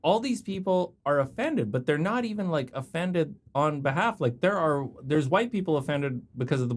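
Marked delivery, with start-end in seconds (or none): none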